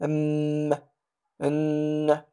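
أم, أن with the closing m and n held as a level hum; sound from nasal cavity. Shown as a demonstration. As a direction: hum أم and أن through the nose. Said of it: "هذا الصوت يخرج من الخيشوم".

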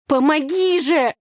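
high, loud, drawn-out effortful plea; straining. A narrator's reading. Girl climbing into a boat calls for help, straining. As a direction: plead while heaving yourself up. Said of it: "кряхтела я".

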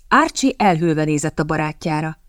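ordinary delivery, nothing marked

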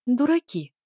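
ordinary delivery

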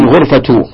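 غرفة as "ghurfatu"; damma on final ta. Shown as a demonstration. Teacher querying the student's reading, damma ending on غرفة, "ghurfatu". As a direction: end غرفة in -tu, no tanween. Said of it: "هذه الضم غرفة".

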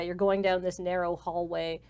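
ordinary talk, nothing marked

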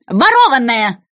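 loud king's cry; exclamation. Acted. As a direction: cry it out accusingly. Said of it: "воскликнул король".

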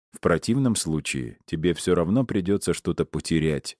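ordinary delivery

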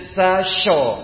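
"sa" then a thick hushing "sho"; wrong sh for sad. Shown as a demonstration. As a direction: show the mistake, "sho" with sh instead of emphatic s.

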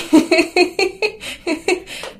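loud, high burst of laughter. Real, not acted. Laughs at the awkward memory.

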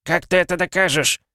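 high, pushy, defiant challenge; defiant merchant voice. Acted. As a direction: throw it back like a cornered merchant. Cornered merchant defies accuser.

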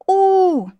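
long, high, loud "oo" wail; agonised howl. A narrator's reading. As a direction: wail as if seized by a fit. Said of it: "затряслась я, как одна из послушниц". (воет, будто в припадке)

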